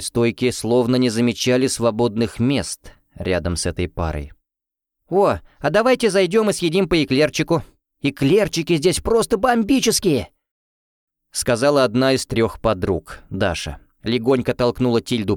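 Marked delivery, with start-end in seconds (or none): 5.1-10.3 bright, high and eager; excited. A narrator's reading. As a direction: coax friends to go in. Girlfriend urging eclairs.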